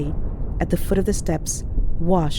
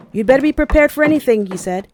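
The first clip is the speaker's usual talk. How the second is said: high, loud, fast alarm; urgent warning; 6 semitones higher, 7 dB louder than usual. A narrator's reading. Soldier from next dugout warning comrades, urgent loud alarm. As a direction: burst in and warn them, fast and high.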